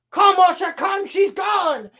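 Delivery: high, dramatic calling; retold desperate shout; mock urgent plea. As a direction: urge her away, hammed-up. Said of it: "he's like".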